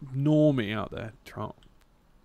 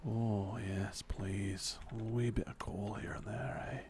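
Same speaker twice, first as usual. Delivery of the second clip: put-on Australian accent; 4 semitones lower, 9 dB quieter, slower than usual. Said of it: "that's the accent that he's trying to do".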